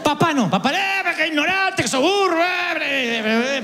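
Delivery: imitating dad's high, half-hearted yell; mock yelling. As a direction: rant like a fed-up father. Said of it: "o sea, gritaba un poquito". (imitación de un grito de papá, agudo y burlón)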